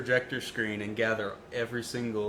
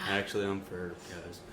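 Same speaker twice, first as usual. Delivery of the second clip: deadpan; 4 semitones lower, 7 dB quieter than usual. Retold or imitated. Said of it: "probably exactly how I said it".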